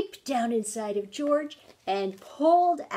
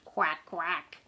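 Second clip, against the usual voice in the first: duck quack; 5 semitones lower than usual. Acted. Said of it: "George went".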